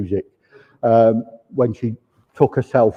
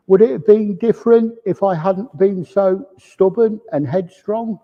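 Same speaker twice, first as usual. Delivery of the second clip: higher, teary voice, mildly choked; a touch of tears in a higher voice; tearful; 7 semitones higher than usual. Retold or imitated. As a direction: voice the man's regret as if near tears. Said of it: "he's got tears in his eyes".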